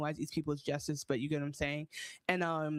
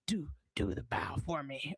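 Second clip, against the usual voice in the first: low whispered creepy clown; hushed, lower clown voice; Pennywise impression; 4 semitones lower than usual. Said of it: "what's Pennywise's voice like?".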